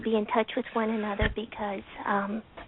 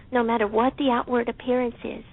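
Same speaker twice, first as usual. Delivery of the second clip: usual speaking voice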